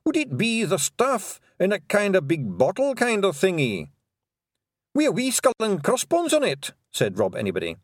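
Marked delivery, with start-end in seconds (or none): 0.0-6.7 high, brisk Scottish wee-man voice; a tiny rowdy Scottish warrior, pitched up; cocky Scots Feegle; Scottish pixie. Acted. Feegles describe bottle.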